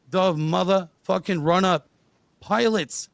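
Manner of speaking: normal talking voice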